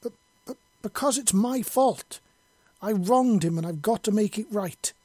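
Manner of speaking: stammered start, then high, loud outburst; desperate yell. A narrator's reading. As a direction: yell a confession at the air. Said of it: "Yelena yelled at the air".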